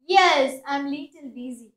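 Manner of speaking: ordinary delivery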